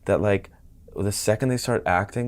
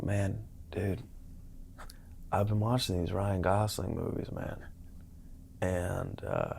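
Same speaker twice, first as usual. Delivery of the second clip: LA actor buddy; 12 dB quieter than usual. Retold or imitated. Actor buddy confiding his Gosling discovery to a friend, hushed. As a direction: share it softly, like a secret discovery.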